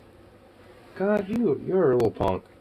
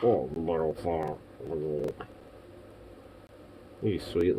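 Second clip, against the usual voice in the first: cooing softly; 5 semitones lower, 9 dB quieter than usual. Real, not acted. Cooing at the pet.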